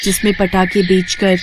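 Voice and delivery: a freed fairy thanking her rescuer; grateful fairy. Acted. Fairy grants wand.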